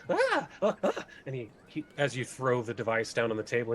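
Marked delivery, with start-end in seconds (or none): 0.1-1.1 very high excited gnome gasps. Acted. Gnome sees the device, very high excited gasps. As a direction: gasp with delight. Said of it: "Norman goes".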